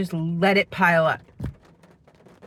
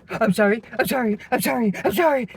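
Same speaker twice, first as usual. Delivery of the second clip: imitated frantic apologizing, climbing higher with each repeat; mock panicked apology; 4 semitones higher, 3 dB louder, faster than usual. Speaker imitating housemate bolting from the room, mock panicked apology. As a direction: mimic someone fleeing in a fluster of sorries. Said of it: "like I was screaming at her".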